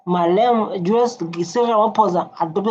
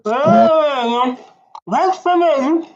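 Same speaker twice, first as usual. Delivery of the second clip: surprised; 8 semitones higher, 3 dB louder, much slower than usual. Real, not acted. Shocked reaction to clip claim.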